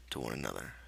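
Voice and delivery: ordinary delivery, nothing marked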